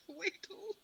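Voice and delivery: surprised. Acted. Incredulous repeat of odd word.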